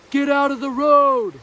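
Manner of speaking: very loud, high, drawn-out yell; dad yelling. Retold or imitated. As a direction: stretch it out like a father bellowing across the street. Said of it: "Dad, yelling".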